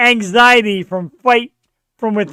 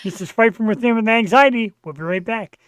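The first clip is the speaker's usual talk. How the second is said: ordinary delivery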